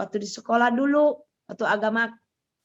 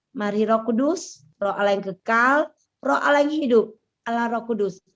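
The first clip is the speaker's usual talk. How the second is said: heartfelt prayer; 2 semitones higher than usual. Acted. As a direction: pray it gently, as if alone with God. Prays aloud over music.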